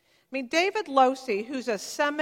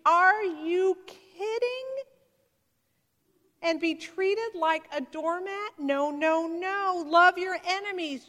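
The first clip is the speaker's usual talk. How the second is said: mock incredulity; 5 semitones higher than usual. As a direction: scoff at an impossible command. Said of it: "assuming they're out of reach".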